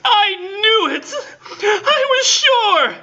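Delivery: hammed-up triumphant wail, slow; exulting cry. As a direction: shriek triumph through sobs. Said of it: "exulting and terrible cry".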